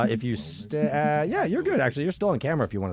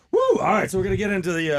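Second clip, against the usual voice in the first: upbeat shout; 6 semitones higher, 5 dB louder than usual. Real, not acted. Host pivoting to the fun part.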